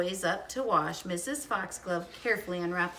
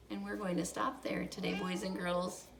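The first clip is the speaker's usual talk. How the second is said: soft sign-off; 8 dB quieter than usual. Real, not acted. Reader signs off to children.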